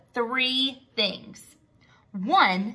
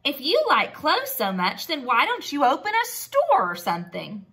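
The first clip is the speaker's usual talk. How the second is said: high squeal then sarcastic jeer; sarcastic mole rats; 4 semitones higher than usual. Acted. Mole rats jeer sarcastically at Wilbur, high. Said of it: "they said".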